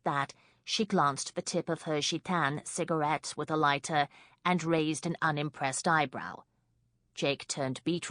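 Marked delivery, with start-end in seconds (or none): none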